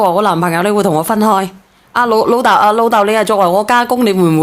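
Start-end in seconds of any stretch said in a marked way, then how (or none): none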